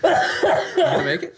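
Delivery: squeaky laughing question. Real, not acted. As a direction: ask it while cracking up. Teasing friend through laughter.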